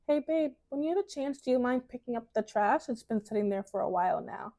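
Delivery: sweet, gently coaxing ask; delicate, soft request. Shown as a demonstration. Woman gently asking her babe about the trash, delicately. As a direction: start light and high, then settle softly. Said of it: "use your words delicately".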